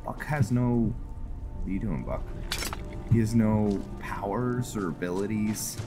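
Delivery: normal talking voice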